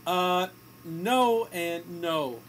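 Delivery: usual speaking voice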